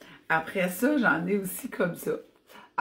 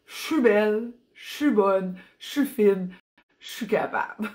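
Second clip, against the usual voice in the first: punchy Acadian French, "je suis" squeezed into "chu"; Acadian accent; 2 semitones higher, 3 dB louder than usual. Shown as a demonstration. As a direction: say it proudly in your own Acadian accent, louder. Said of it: "avec mon accent".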